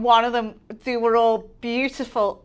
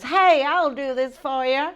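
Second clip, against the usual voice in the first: slow, higher, louder mock American voice; bad American accent; 4 semitones higher, 6 dB louder, slower than usual. Retelling an American guy's offer to take a selfie, bad American accent. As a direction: attempt a hammed-up American drawl. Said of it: "Can't do American accent, guys".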